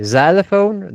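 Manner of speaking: "xylophone" opening on a clear z, loud and high; first sound Z. Shown as a demonstration. Teacher showing the first sound of xylophone, said as z. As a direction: stress the start of "xylophone": ZAI-luh-fone. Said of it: "the first sound is".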